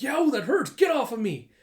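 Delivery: high, loud yelping protest; acted pain yell. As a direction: yell as if a dog has your leg. Arsonist bitten on the leg.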